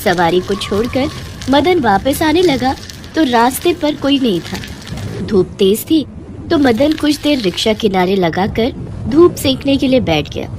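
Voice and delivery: plain reading voice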